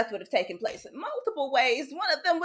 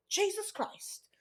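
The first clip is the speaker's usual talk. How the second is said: hushed dread; 9 semitones higher, 10 dB quieter, slower than usual. Retold or imitated. Remembering the last whooping.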